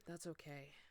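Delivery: soft, low dejected forgiveness; depressed. A narrator's reading. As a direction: sigh it out, all hope gone. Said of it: "all depressed".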